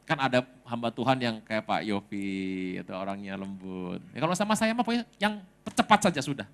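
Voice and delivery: normal talking voice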